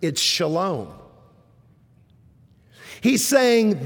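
ordinary talk, nothing marked

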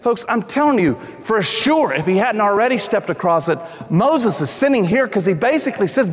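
ordinary talk, nothing marked

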